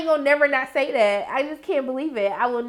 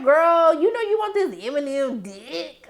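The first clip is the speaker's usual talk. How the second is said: teasing call-out; 4 semitones higher than usual. Retold or imitated. Acts out teasing Roulette.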